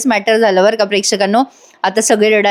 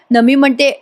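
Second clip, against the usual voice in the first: ordinary talk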